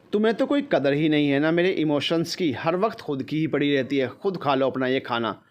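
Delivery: usual speaking voice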